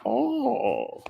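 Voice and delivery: high, mocking put-on 'oh'; hammed-up imitation of a sitcom character; mock a film villain's odd accent; Braxton from Jamie Foxx show. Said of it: "You sound like Braxton from Jamie Foxx show".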